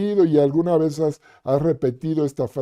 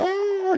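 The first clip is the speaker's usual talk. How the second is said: high mock shriek. Retold shriek of shock.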